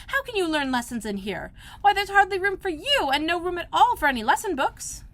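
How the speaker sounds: put-on high, scolding girlish voice; a girl scolding herself; Alice's voice. A narrator's reading. Alice scolding herself, high girlish voice.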